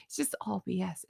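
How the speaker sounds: dropped low and soft; a quiet mutter of It's just all B S. Real, not acted. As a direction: mutter it under your breath. Repeated dismissal, first muttered.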